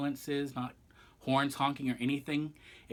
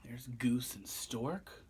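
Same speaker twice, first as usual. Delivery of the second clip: whispered aside; 7 dB quieter, slower than usual. Real, not acted. Reader whispers aside over pictures.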